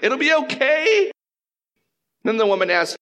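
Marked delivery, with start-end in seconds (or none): 0.0-1.1 slow, drawn-out soothing from Saul, rising high at the end; hammed-up Saul calming the witch; mock reassurance. Preacher mocking Saul's comfort to the witch, slow and soothing. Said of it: "the irony of what he's saying".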